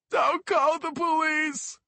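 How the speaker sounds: high, slow, loud begging; frightened plea. Acted. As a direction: beg them like a scared child. Kid begs the cops.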